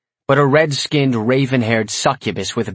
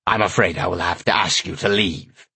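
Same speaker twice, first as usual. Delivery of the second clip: cold menace; 4 semitones lower than usual. A narrator's reading. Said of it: "face grew cold and menacing".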